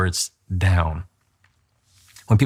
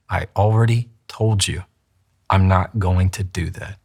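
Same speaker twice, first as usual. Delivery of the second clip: measured words with a pause midway; slow it down, in full control; slowed down, controlled. Shown as a demonstration. Coach shows the same line with full control, slowed down. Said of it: "if I slow it down".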